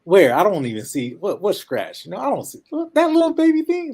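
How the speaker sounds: high, loud mock concern climbing to a squeak; exaggerated joking disbelief, pitch leaping upward; playful sarcasm. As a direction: tease a patient by hamming up how tiny the injury is. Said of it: "a little bit of sarcasm".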